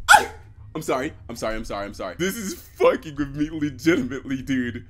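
ordinary talk

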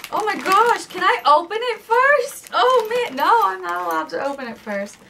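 high, loud excited squeal; delighted opening a present. Pretend. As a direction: squeal like a kid with a gift. Voicing the excited dog.

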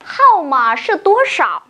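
Hao mà sư tố sảo asked in Mandarin with falling tones; Mandarin question. Shown as a demonstration. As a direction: model the question for the class. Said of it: "cô sẽ hỏi như sau".

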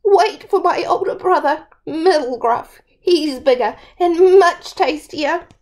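high little goat voice; pleading little goat. Acted. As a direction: talk the troll out of eating you.

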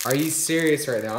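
normal talking voice

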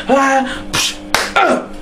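hissed knockout sound effects; mock punch noises. Acting out the knockout, with punch noises. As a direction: make the hit and the fall with your mouth.

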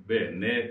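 ベーネ long first vowel, sounded final E; full final vowel. Shown as a demonstration. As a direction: say ベーネ in full, two clear syllables. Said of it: "最後のエの母音が入る". (「ベーネ」と最後のエまで発音)

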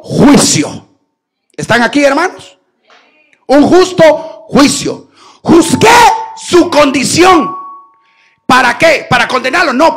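loud shouting sermon cadence; fiery preaching. Acted. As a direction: drive it home to the flock. Preacher at full force.

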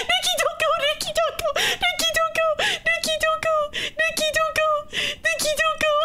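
high, repeated desperate calls; frantic pleading. Real, not acted. Player begging Nikki not to walk away, frantic. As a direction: beg her over and over not to leave. Begs teammate not to leave.

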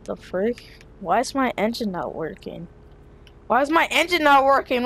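ordinary delivery

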